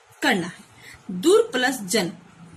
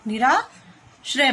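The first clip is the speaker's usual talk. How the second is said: slowed, careful निराश्रय; slow answer word; much slower than usual. Shown as a demonstration. Teacher answering own question for pupils, slowed. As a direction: give निराश्रय slowly as the answer. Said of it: "तो क्या बनेगा?".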